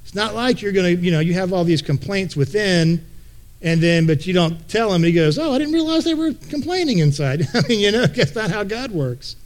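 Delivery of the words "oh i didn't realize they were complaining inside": a hammed-up surprised God, pitched higher; mock clueless God. Preacher mocking the idea that God does not know. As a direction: play God pretending he had no idea, sounding high and surprised.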